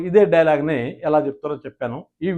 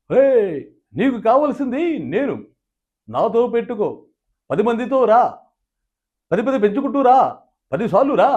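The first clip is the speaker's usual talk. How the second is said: Balayya dialogue impression; 6 semitones higher than usual. Mimicking Balayya's faction dialogue to a rival, high and booming. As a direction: hurl the challenge like a mass hero. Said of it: "ఓ సినిమాలో dialogue చెప్తాడు బాలయ్య గారు".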